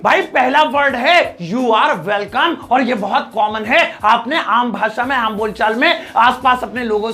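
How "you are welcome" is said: very slow, measured English reply; slow model phrase. Shown as a demonstration. Teacher introducing the reply to thanks, very slow and measured. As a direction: draw out each word for learners.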